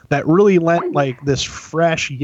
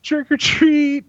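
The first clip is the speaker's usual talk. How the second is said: high, drawn-out mimicked kids' call; kids trick-or-treating; 12 semitones higher, much slower than usual. Kids calling to whoever opens the door, high mock chant.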